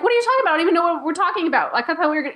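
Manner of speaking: high, rushed bewilderment; flustered protest. Retold or imitated. Acts out her flustered protest.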